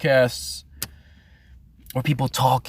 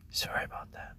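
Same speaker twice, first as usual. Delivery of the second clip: unvoiced hushed apology; breathy sorry whispered; 15 dB quieter, faster than usual. Whispered repeat.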